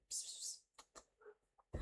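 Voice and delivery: slow whispered psst then soft hello; whispered call to pet. Real, not acted. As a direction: coax the dog in a whisper. Calling the dog softly.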